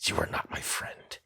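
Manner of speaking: unvoiced whisper, imitating him; soft, creepy imitated whisper. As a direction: whisper it as if behind someone's head. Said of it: "whispers behind my head".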